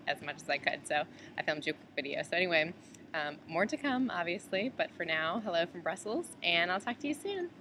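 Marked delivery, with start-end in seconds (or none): none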